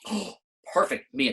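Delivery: ordinary delivery, nothing marked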